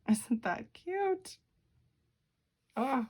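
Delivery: high sweet coo at the cute image. Real, not acted. Cooing over the picture.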